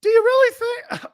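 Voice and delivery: very high caricature radio voice; high-pitched West Coast host. Shown as a demonstration. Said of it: "I'm doing in the high pitch".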